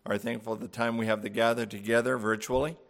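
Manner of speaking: hushed, reverent prayer voice. Acted. Pastor opening the Mother's Day service with prayer, hushed and reverent. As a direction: pray softly over the congregation.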